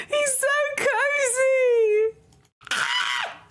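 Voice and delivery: very high, slow squeal, almost crying with delight; squealing over cuteness. Real, not acted. Gushing to chat about how cozy her pet is, high squealing.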